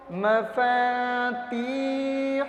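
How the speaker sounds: long-held aa and ee, ending in throaty hu; drawn-out demo. Shown as a demonstration. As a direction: stretch مفاتیح slowly, closing on a short "hu". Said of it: "آخری حلق سے نکالتے ہیں". (مفاتیح کھینچ کر، آخر حلق سے)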